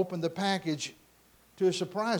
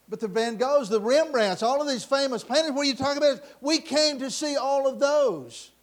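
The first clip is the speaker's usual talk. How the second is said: loud, high outcry; indignant protest; 8 semitones higher, 8 dB louder than usual. Acted. Bidders when the auction is finished, protesting loudly. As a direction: shout in disbelief at being cheated of the show.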